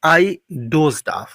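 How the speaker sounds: very slow, deliberate model phrase. Shown as a demonstration. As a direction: draw it out so students catch it. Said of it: "अब इसको हम कैसे कहेंगे". (बहुत धीमे, साफ़ बोला गया वाक्य)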